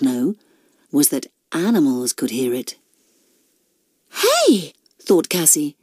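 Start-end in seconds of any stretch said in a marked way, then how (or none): 4.2-4.7 high, perked-up exclamation. A narrator's reading. Cassie hears what she takes for a train, high excited voice. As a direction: perk up suddenly, voice pitched right up.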